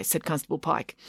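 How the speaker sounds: usual speaking voice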